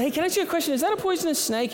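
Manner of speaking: put-on high-pitched innocent city-boy voice; high naive question. To the country neighbor, asking about the snake, high and naive.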